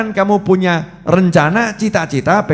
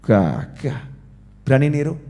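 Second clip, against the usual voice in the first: ordinary talk, nothing marked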